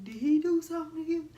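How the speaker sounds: hammed-up angry father; protective dad. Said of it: "He like".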